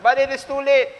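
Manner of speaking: too late cried out high; rising cry. Acted. Preacher crying out to mothers, high warning call.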